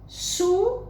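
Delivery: SU high with a strong hissed S; strong S sound. Shown as a demonstration. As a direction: stress the hard S in SU. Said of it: "observaram que tem um som forte".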